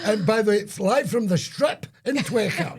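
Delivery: high, loud hammed-up live announcer; mock announcer. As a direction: sell it like a live TV hype man. Mock live broadcast intro.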